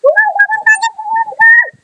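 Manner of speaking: high, piercing scream of shock; high shriek of fright. Real, not acted. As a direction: scream it out, startled and disgusted. Shocked scream at scary game moment.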